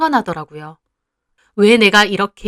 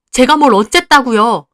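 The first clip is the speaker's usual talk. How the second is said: louder, higher indignant retort; hurt daughter talking back; 4 semitones higher, 6 dB louder than usual. Acted. Daughter snapping back at her mother, louder and indignant. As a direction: demand what you did wrong.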